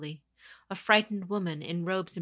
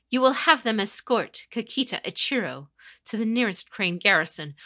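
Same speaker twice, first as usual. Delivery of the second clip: louder, higher commanding voice; stern commander; 4 semitones higher, 8 dB louder than usual. Acted. Conqueror dictating terms to her captain, commanding and loud. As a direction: issue orders with authority.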